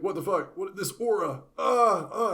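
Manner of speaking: hammed-up fright at a sudden aura; startled panic. Everyone sensing Orsted's aura, in mock alarm. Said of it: "everyone's all like".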